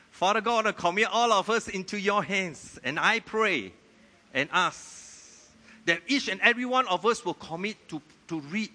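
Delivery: loud, high prayer over the congregation; fervent prayer. Real, not acted. Pastor praying over the church, fervent and loud. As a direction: pray out with rising fervour.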